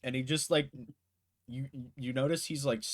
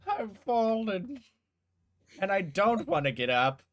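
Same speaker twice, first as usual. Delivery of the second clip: hammed-up cry; 10 semitones higher, 9 dB louder than usual. Game master jokes as the fallen character, hammed-up loud cry.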